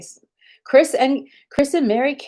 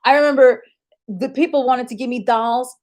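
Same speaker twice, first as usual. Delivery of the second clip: usual speaking voice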